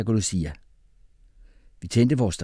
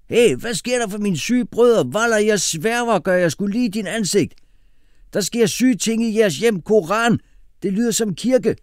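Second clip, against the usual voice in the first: high, loud, animated slang voice; Kasim, street-slang brother; 12 semitones higher, 5 dB louder than usual. A narrator's reading. Kasim bumping into the boys in the stairway, loud street talk. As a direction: pitch it high and push it out loudly.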